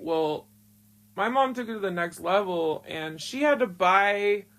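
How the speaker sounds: ordinary delivery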